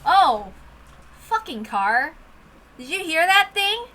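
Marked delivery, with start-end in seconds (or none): none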